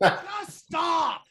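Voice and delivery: high, drawn-out mock shout; retold yelling. Film fan replaying yelling at the screen, mock shout. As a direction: plead it in a hammed-up high yell. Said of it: "I'm yelling, going".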